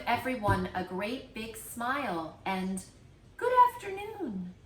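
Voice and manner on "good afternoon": higher and louder bright hello to the whole bus; grandma greeting the passengers cheerfully; cheery greeting. Acted. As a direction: sing it out to everyone aboard. Nana greets everyone on bus.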